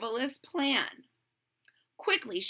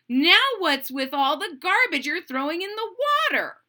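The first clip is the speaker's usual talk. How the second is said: louder, higher stern question; scolding; 5 semitones higher, 5 dB louder than usual. Acted. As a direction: demand an answer. Serendipity scolds Smudge.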